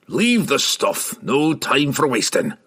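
choked growl, slow and clipped off; gruff dwarf. A narrator's reading. Dwarf ordering companions to abandon supplies, gruff. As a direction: push the order through a rough throat. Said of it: "clearing the choked growls".

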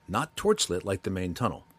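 ordinary delivery, nothing marked

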